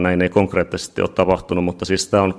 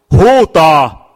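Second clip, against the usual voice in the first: Huutaa! yelled out much higher and louder, falling; shouted demo; 9 semitones higher, 9 dB louder than usual. Shown as a demonstration. Preacher showing the congregation how to cry out, full shout. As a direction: belt out Huutaa! as a desperate cry. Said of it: "siinä ei sanota kuiskaa, vinkkaa, vaan huutaa".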